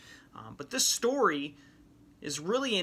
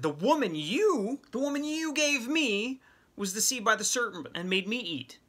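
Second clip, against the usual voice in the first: defensive blame; 8 semitones higher than usual. Retold or imitated. Adam blaming Eve before God.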